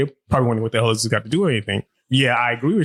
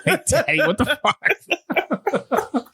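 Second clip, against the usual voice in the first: put-on high, laughing kid voice calling out her daddy; kid's voice; 13 semitones higher, much slower than usual. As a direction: squeak it like a mortified daughter, half-laughing. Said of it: "She gonna be like".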